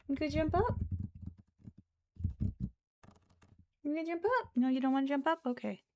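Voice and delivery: high cooing voice to a pet. Real, not acted. Cooing high to her pet rice cake.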